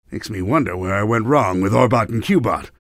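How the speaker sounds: ordinary talk, nothing marked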